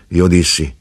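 usual speaking voice

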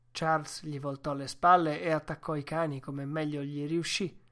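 ordinary delivery, nothing marked

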